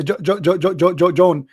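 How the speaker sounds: stuck stutter on the first name; nervous stammer retold. Reading the roll call to the sergeant, nervous stutter. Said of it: "and I, I said".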